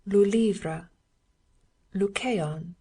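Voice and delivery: French nouns said with French pronunciation, one by one with pauses; French word drill. A narrator's reading. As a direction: recite like a French lesson, in a French accent. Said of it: "French nouns and prefixes like this".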